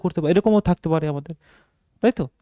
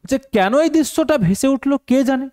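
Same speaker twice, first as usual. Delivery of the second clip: exclamatory reading; 11 semitones higher than usual. Shown as a demonstration. Reading exclamatory line from passage.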